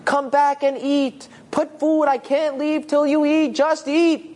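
high, insistent shouted orders; yelling. Acted. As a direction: bark after him as he walks away. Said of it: "And Yosef yells again".

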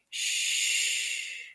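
calm her down with a hush; shushing. Acted. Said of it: "you say".

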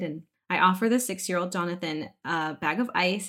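ordinary talk, nothing marked

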